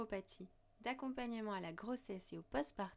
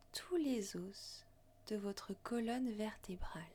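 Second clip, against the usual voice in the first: guided meditation. Acted. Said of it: "Je vous invite à suivre ma voix lors d'une méditation".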